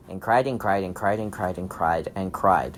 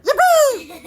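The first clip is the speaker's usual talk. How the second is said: high, loud gleeful cheer; gleeful yippee; 4 dB louder than usual. Acted. Dad in the story cheering because the crying stopped, high loud glee. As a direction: squeal with delight as the silly dad.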